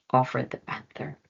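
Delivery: plain reading voice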